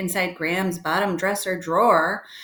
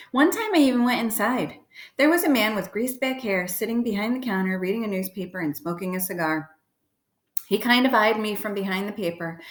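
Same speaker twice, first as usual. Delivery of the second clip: ordinary delivery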